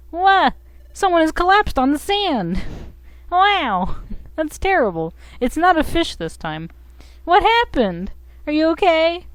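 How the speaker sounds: high-pitched, alarmed game character; startled creature partner. Acted. Partner finds someone collapsed on the sand, high startled voice. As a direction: pitch it way up, concerned.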